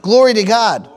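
fiery preacher rebuke; preaching at full force. Acted. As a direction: drive the rebuke home to the church.

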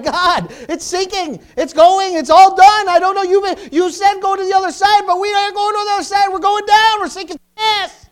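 high, loud panicked cry; panicked disciples. Retold or imitated. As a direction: shout it like men sure the boat is going down. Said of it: "They were like".

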